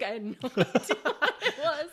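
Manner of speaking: usual speaking voice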